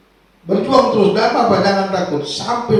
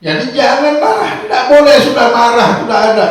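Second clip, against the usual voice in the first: higher and louder, almost shouting the warning; forceful preaching shout; 8 semitones higher, 8 dB louder than usual. Real, not acted. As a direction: hammer the warning home to the congregation. Preacher stressing the point.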